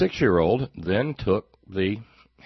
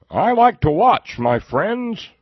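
high, loud, drawn-out put-on voice; hammed-up mocking impersonation; 9 dB louder, slower than usual. Host mocking a pundit who likes to watch, hammed-up high voice. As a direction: blare it out as a mocking caricature.

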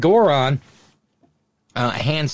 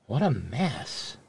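ordinary talk, nothing marked

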